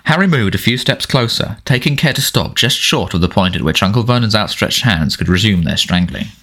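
ordinary delivery

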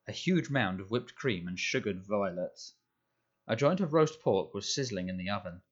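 usual speaking voice

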